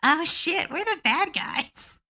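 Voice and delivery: mock stunned player; dawning guilt. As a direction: let it sink in you're the villain. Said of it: "the players had a genuine moment".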